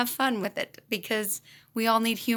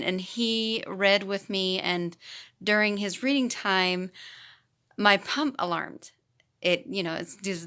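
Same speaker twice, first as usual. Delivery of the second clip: ordinary talk, nothing marked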